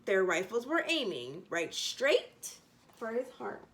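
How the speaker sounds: ordinary delivery, nothing marked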